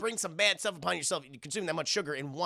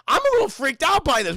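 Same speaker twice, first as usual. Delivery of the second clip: mock freak-out; 9 semitones higher, 9 dB louder than usual. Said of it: "I'd be like".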